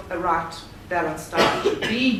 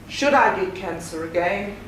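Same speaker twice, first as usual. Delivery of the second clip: ordinary talk, nothing marked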